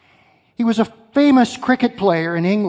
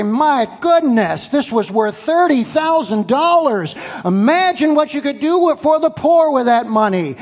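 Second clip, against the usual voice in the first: higher, louder mock outrage at the waste; mock indignation; 7 semitones higher, 4 dB louder than usual. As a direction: play the scandalised grumbler, half-joking. Preacher voices complaining disciples.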